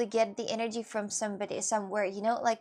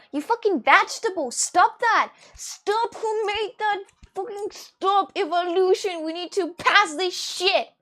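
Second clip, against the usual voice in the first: loud, raised-pitch angry tirade; pissed-off rant; 5 semitones higher, 7 dB louder than usual. Real, not acted. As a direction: rage at the unfair rules, building to shouts. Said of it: "I'm just so pissed about that".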